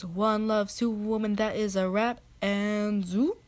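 loud YouTuber sign-off; Superwoman. Acted. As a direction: punch out a hyped outro, flipping up on the final word. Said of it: "at the end she goes".

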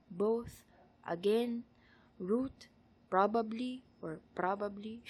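Tagalog accent, each word lifted at the end; Tagalog-accented English without caricature; careful word list in a Tagalog accent. Shown as a demonstration. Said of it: "Tagalog accent that I can muster".

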